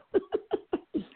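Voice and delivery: a high burst of laughter. Real, not acted. Guest laughing high after his impressions. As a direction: laugh high and bright at yourself.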